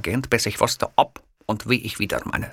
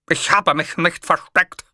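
higher, louder, slow protest; 10 semitones higher, 5 dB louder than usual. A narrator's reading. Hitler protesting to the kiosk man, raised and slow. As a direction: insist he never hid. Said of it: "protestierte ich".

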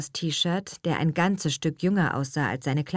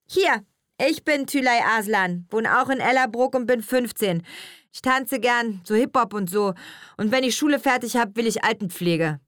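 lightly voiced casual schoolgirl, higher pitch; a hint of street-talking teenager; teenage pupil; 6 semitones higher, 3 dB louder than usual. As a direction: introduce yourself like an offhand teen. Teenage girl introduces herself.

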